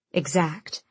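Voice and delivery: ordinary delivery, nothing marked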